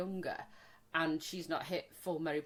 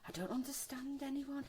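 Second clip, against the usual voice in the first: mock whisper; 5 semitones higher, 11 dB quieter than usual. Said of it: "mocking younger friends".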